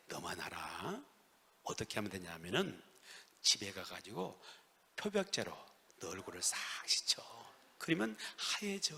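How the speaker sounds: low, hushed conspiratorial voice; confiding a secret. Acted. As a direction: share it softly, like a secret between classmates. Classmate confides a secret tip. (낮게 속삭이듯 비밀을 알려주는 말투)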